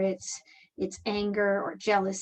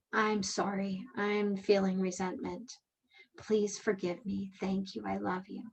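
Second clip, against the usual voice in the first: hushed private plea, trailing softer; quiet inward prayer; 8 dB quieter than usual. Retold or imitated. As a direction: say it softly, as if only in your mind.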